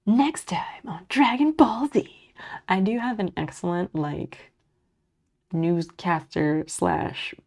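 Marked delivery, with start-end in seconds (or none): 0.0-2.3 slow dramatic trailer announcer voice; TV preview announcer. Shown as a demonstration. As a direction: do the cartoon next-episode announcer. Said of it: "previews T V guy voice".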